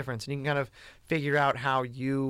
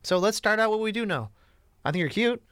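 playful reassurance; 6 semitones higher than usual. Retold or imitated. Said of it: "you just go".

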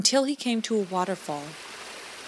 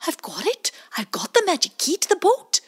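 high, louder, fast triumphant otter; excited otter; 10 semitones higher, 5 dB louder than usual. Acted. Bowie tells Pie Jam he got the key, excited. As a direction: burst out with the news.

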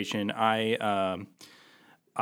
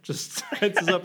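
ordinary talk

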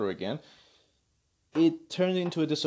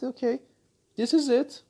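higher, breezy mock declaration; mock announcement; 8 semitones higher, 5 dB louder than usual. Studio admitting the last film, breezy high mock tone. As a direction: play the studio finally giving up. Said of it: "just come out and say that".

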